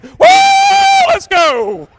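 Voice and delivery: long, very high shouted whoop; wild whoop. Retold or imitated. Preacher agreeing to dance, wild exuberant whoop. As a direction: let loose a huge hollered cheer. Acts breaking into dancing.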